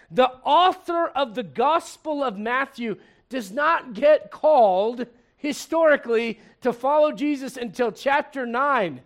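normal talking voice

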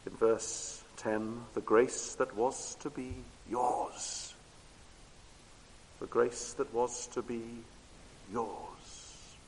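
soft, unhurried preaching that draws listeners in; hushed sermon. Acted. Preacher speaking to friends tonight about the grace that was theirs, hushed and intimate. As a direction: lean in and speak it close and low.

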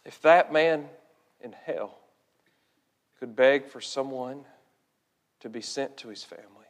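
low, very soft pulpit appeal; hushed and grave, leaving long pauses; solemn preaching. Real, not acted. Preacher urging the saved to pray for laborers, hushed and solemn.

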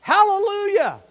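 high, loud, joyful hallelujah; exuberant praise. Shown as a demonstration. Preacher urging Baptists to praise exuberantly, joyful loud cry. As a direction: show them how to praise out loud. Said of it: "exuberant in our praise".

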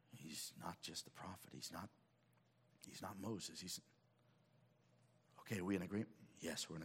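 a hint of a secret huddle; hushed huddle. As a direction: whisper it like disciples huddled together. Disciples huddling, conferring.

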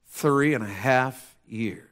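drawn-out, weighty delivery; slow emphasis. Real, not acted. Preacher lets the long wait sink in, slow. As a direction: stretch each word out slowly. Repeats answer slowly for weight.

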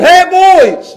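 loud, high call across the house; hollering. Retold or imitated. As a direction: holler for the boys. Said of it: "I hollered".

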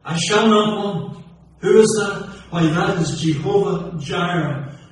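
loud, high, ringing preacher's cadence; preaching at full force. Acted. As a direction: hammer each name of God home to the congregation.

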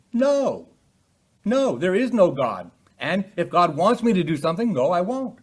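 high, loud defiant refusal; defiant unbeliever. Acted. Preacher acting the sinner who tells God no, loud. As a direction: shout down God like a stubborn sinner.

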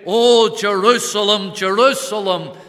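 a loud anguished cry over the city. Acted. Preacher voicing the Lord crying out over Jerusalem, loud lament. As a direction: call out loudly, full of grief. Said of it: "when the Lord cries out".

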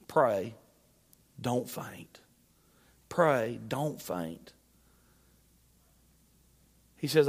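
low, soft closing appeal with long pauses; tender preaching. Acted. Preacher urging the burdened to keep praying, tender hushed appeal. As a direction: bring it right down and let each phrase settle.

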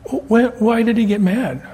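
put-on high child voice, stammering a why; playing a puzzled little girl; little granddaughter. Grandpa voicing granddaughter asking why God got mad, high and childlike.

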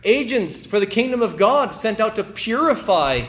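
loud, high, ringing pulpit delivery; preacher thundering the scripture at the congregation; full-force preaching. Acted. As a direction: proclaim it to the whole church. Preaching the salt passage.

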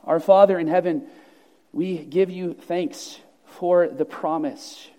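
ordinary talk, nothing marked

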